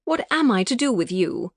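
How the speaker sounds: mock despair in a proper English accent; fond English-accented tutting; prim English accent. A narrator's reading. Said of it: "the prim and proper accent".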